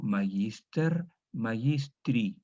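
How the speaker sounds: magister, magistri spelled out slowly, syllable by syllable; slow model reading. Shown as a demonstration. Teacher dictating the declension to students, slow and clear.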